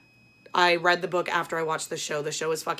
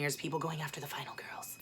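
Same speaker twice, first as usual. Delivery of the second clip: whisper; 4 semitones lower, 14 dB quieter than usual. Acted. Thriller plot, ominous aside.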